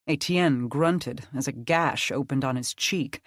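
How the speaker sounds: plain reading voice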